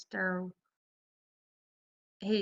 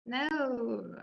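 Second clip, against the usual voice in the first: real Southern drawl; drawn-out Southern vowel on "No"; natural Southern-accented protest; 3 semitones higher than usual. Real, not acted. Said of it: "You just said no in Southern".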